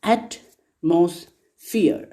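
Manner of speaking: "Atmosphere" very slowly as AT, MOS, PHERE, with gaps; "Atmosphere" stretched into three slow beats; three separate syllables. Shown as a demonstration. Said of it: "There are three syllables".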